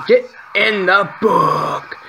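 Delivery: high, drawn-out frustrated command; long, high gamer yell; exasperated yell. Real, not acted. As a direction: stretch each word in mounting frustration. Yells at enemies in game.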